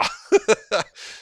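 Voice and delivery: high giggle after the bit; real laughter. Real, not acted. Gamer laughs at his own impression, high giggle. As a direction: burst out giggling.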